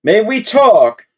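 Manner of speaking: called down from above, high and loud; shouting down. A narrator's reading. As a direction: shout it down the stairs. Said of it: "shouts down".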